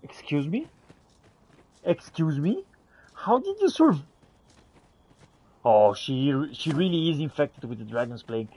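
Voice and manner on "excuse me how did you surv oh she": normal talking voice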